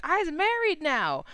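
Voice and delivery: high, slow, pointed mock announcement; playing the newly married wife, slow and high; smug reminder. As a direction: lift it high and stretch it teasingly. Wife reminds him she's married.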